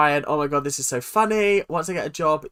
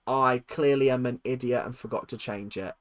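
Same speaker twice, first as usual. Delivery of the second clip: ordinary delivery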